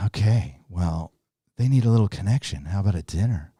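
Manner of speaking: lightly imitated sultry computer; a hint of a seductive computer voice; mock-sexy computer making a suggestion. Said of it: "that very sexy British voice".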